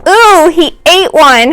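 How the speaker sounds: high, slow squeal of disgust; squealing girl. Acted. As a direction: squeal it out, half thrilled and half grossed out. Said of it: "Heidi squealed".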